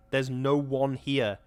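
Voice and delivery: slow, strained, each word pressed; strained emphasis. Acted. Wife insists the town is empty, strained emphasis. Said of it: "with a queer, strained emphasis".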